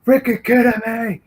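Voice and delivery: high, incredulous snap of anger; mad disbelief. Retold or imitated. Man yelling after the runaway attacker, mad disbelief. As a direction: shout it at the fleeing man. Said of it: "like, mad".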